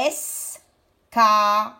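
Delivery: "escalier" in three parts, es, ca, lier; slow syllable-by-syllable "escalier"; split into syllables. Shown as a demonstration. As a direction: stretch and separate es-ca-lier. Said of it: "Quelle syllabe on va prendre".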